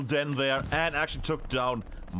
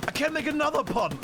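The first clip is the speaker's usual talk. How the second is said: pitched up, punning again; higher repeat; 8 semitones higher than usual. Shown as a demonstration. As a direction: lift the voice, teasing the pun. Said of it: "Oh, it's awful".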